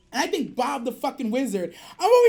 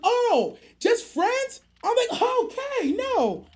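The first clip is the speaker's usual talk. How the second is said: hopeful excitement; 9 semitones higher, 3 dB louder, slower than usual. Retold or imitated. Hopeful retold thought.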